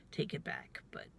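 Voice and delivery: normal talking voice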